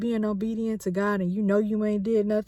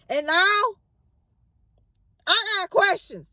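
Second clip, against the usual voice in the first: distressed; 8 semitones higher than usual. Retold or imitated. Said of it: "This was Job's feeling".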